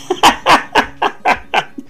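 an unvoiced, airy burst of laughter; a soft high wheeze of a laugh; breathy laugh. Real, not acted. Laughs at friend's teasing.

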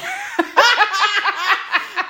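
high loud burst of laughter; real laughing fit. Real, not acted. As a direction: cackle at the silly name. Laughing at fanfic author name.

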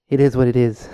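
a light impression of a politician's shrug; quoting the president. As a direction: toss it off like the president does. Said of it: "to quote our guy".

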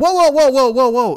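loud, high string of "whoa"s, easing off; alarmed shout. Retold or imitated. Diner loudly stopping the waiter who stacked his soup. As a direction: stop the waiter mid-move. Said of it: "and I go".

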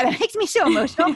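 host laughing as she gets teary about comparison; emotional laugh. Real, not acted. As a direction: laugh it off as the tears come. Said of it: "Like, I'm starting to get emotional".

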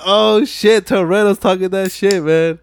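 high, loud fan excitement; hyped reaction. Retold or imitated. Acting own excited reaction to scene.

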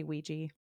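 Ouija said as WEE-jee; wee-jee pronunciation. Shown as a demonstration. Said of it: "I say".

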